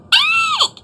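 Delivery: very high troll screech; little troll screeching in fright, very high. Acted. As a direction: shriek it in terror like a little troll. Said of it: "he screeched".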